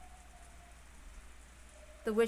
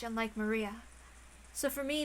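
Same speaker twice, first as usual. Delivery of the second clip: ordinary delivery, nothing marked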